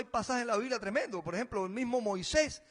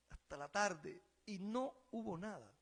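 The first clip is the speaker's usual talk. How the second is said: normal talking voice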